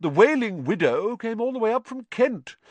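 high, pleased boasting; self-satisfied host, voice lifted; proud. Acted. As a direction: boast about a guest who travelled far. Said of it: "said nearly headless Nick proudly".

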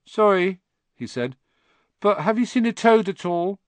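put-on high, nervous boyish pleading; a lost child asking shyly; timid tearful boy.

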